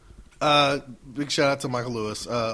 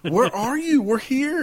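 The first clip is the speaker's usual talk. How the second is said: much higher, louder mock shout; 8 dB louder, slower than usual. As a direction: yell the question up high. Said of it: "And I was like".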